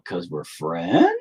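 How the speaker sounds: slow, drawn-out, stating the obvious; mock-obvious reply. Answering the astonished buddy, slow and mock-obvious. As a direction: stretch the last word out.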